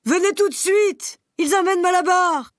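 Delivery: high, loud alarmed cry; frantic shout. Acted. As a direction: yell the alarm to the others. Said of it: "criait-il". (âne affolé qui crie pour alerter les animaux)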